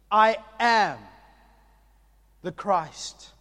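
fervent preaching. Acted. Pastor proclaiming to the congregation, preaching fervently. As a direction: preach it with rising force.

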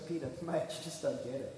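soft, low, gentle reassurance; Jesus soft and patient. Retold or imitated. Jesus gently telling Peter he's already clean, soft and low. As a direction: quietly set Peter straight, like a calm mate. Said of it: "Jesus looks at him and goes".

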